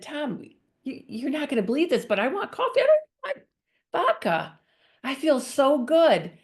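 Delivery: overjoyed wife blurting out good news to her husband; excited. Retold or imitated. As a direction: gush the news at him like you can't contain it.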